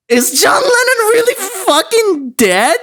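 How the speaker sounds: high, slow, incredulous caller voice; imitating the caller. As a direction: squeak it like a baffled friend. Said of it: "and he goes".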